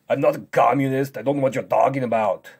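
indignant. Pretend. Strongman mishears commutative and protests, loud and indignant. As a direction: object hotly to a false accusation.

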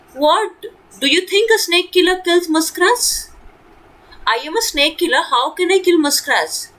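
a touch of mocking disdain; scornful. A narrator's reading. Mongoose scoffing at the frightened muskrat, mildly scornful. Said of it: "said Rikki Tikki scornfully in a disrespectful way".

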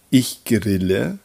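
ordinary talk, nothing marked

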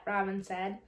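plain reading voice

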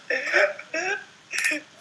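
high burst of laughter; laughing. Real, not acted. Laughing at Callum's gross answer, high laugh. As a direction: crack up at it.

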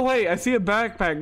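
ordinary talk, nothing marked

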